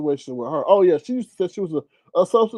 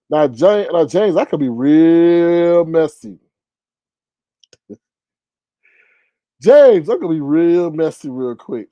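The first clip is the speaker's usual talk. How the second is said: louder, slow playful warning; teasing threat; 8 dB louder, slower than usual. Real, not acted. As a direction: draw it out like a sly threat.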